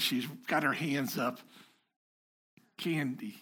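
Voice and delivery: ordinary talk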